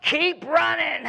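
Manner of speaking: mock coach shout at a straggler; coach yell. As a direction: coach shouting across the track. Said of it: "the coach".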